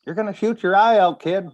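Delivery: high, loud scolding mom; movie mom quote. Retold or imitated. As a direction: warn the kid, high and loud.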